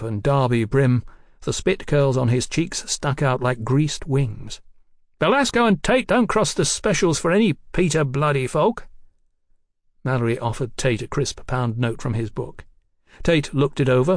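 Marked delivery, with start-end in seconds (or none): 5.2-8.9 high-pitched, proud defiance; indignant rogue detective. Acted. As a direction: declare it with offended pride, pitched up.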